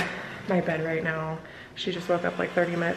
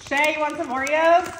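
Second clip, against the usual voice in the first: teasing offer; 9 semitones higher, 5 dB louder than usual. Acted. Offering prank Oreos to daughter.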